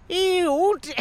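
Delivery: put-on very high, squealed go at an Irish accent; badly faked Irish, pitched absurdly high; attempted Irish accent. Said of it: "Can you do it in the accent?".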